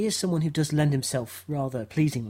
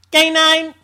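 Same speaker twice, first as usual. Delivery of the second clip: much higher, louder robotic yap; K9 robot dog voice; 13 semitones higher, 10 dB louder, much slower than usual. Pretend. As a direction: bark it like a tin robot dog. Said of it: "do K nine".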